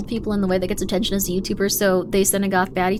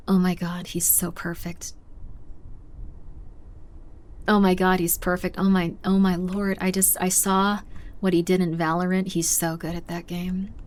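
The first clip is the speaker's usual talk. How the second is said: mock adoration; 4 semitones lower, 5 dB quieter than usual. Swooning goth girl imagined.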